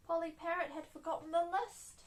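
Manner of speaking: ordinary delivery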